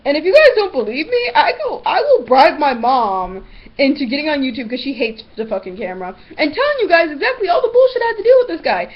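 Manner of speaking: usual speaking voice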